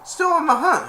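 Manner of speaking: high, loud mock greeting; teasing movie character. Sinbad greeting his rival again, high and teasing. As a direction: call it out high and playful.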